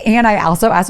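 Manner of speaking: ordinary delivery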